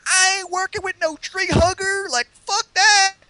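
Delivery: mock the Southern bigot in a hammed-up high twang; thick Southern accent. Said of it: "this guy with a real thick Southern accent".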